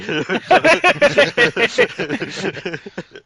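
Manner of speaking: big burst of laughter; loud high laugh. Real, not acted. Laughing at own joke.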